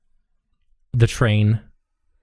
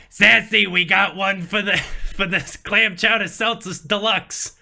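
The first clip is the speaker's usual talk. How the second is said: higher, brassy diner call; a lot higher, calling the order; high waitress call; 6 semitones higher than usual. Acted. Waitress calls the order to her coworker, much higher.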